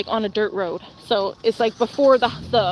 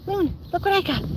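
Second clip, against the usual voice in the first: higher singsong for the dog; teasing pet voice; 8 semitones higher, 3 dB quieter than usual. Real, not acted. Teasing her husky with a rock in high pet voice.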